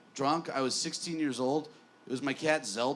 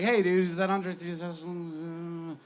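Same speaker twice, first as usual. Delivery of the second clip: slow, mock-casual voice a little higher; Andre playing dumb; 4 semitones higher, slower than usual. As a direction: drawl it out like a star pretending not to know. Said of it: "He's like".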